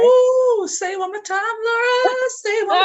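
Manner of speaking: high, loud hype call to repeat it; excited teasing. Real, not acted. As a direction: hype her up to say it again.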